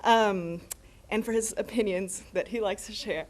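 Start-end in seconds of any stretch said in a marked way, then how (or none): none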